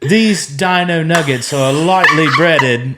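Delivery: slow, pompous chef narration; Gordon Ramsay. Pretend. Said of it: "as if you're Gordon Ramsay".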